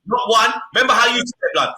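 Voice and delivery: usual speaking voice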